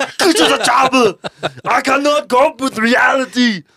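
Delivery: high, shouted mock Austrian accent; hammed-up Austrian accent impression; Arnold Schwarzenegger. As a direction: bellow it like an Austrian action hero. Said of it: "make her sound like Arnold Schwarzenegger".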